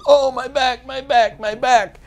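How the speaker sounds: high, loud hammed-up moaning over his back; mock pained wail. Bobby fakes a fall in the aisle, high mock wail. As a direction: ham up a fake back injury, crying out high.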